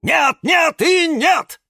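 very high, emphatic shout. A narrator's reading. Cactus refuses to see Валера, high slow shout. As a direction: shout a drawn-out, furious refusal. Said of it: "подпрыгнул на табуретке и закричал".

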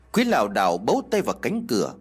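ordinary delivery

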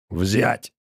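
gurgled curt order; angry command. A narrator's reading. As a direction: bark it like a brute. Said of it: "Квас зло сплюнул ... скомандовал".

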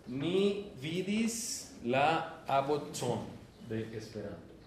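very slow, drawn out for the class; slow teacher model. Shown as a demonstration. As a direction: spell it out slowly like a model sentence. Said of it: "yo te voy a traducir". (muy lento, como modelo para los alumnos)

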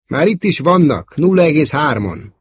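high, loud alarm; alarmed call. A narrator's reading. Wedge warns the others fighters are behind, alarmed. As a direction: sound the alarm.

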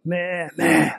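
drawn-out "meee, meee" like a lamb; imitating bleating lambs; imitated lamb bleat. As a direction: bleat like lambs calling their mothers. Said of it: "Kuzuların beli yok".

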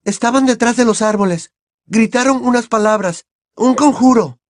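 high, anxious account; trembling. A narrator's reading. Hermione explaining to the wizards what they heard, trembling. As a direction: report it in fright, pitch held high.

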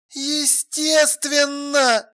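very slow, high, drawn-out answer; emphatic certainty. A narrator's reading. Alla confirming the police are in collusion, emphatic. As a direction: draw the word right out with conviction. Scornful answer about a conspiracy.

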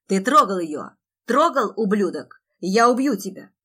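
loud, enraged shouting; shouted rage; furious threat. Acted. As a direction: bellow it as a raging accusation. Enraged brother threatens the man.

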